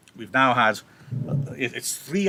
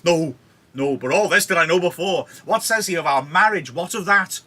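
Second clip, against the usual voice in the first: loud, higher, exasperated Juliet; going nuts; 6 semitones higher, 6 dB louder than usual. Acted. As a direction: demand the answer at the end of patience. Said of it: "Poor Juliet's going nuts".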